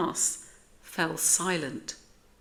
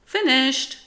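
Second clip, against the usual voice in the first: high, loud announcement; quick, high shout of completion; triumphant cry; 9 semitones higher, 8 dB louder than usual. A narrator's reading. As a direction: cry it out as if the job is done. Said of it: "cried Splat".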